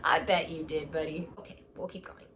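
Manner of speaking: usual speaking voice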